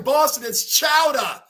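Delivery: "chowder" shouted as Boston "chowdah"; hammed-up Boston accent on "chowder"; loud mock Boston "chowdah". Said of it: "New York accents and Boston accent".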